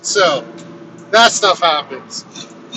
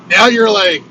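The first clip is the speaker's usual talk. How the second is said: ordinary delivery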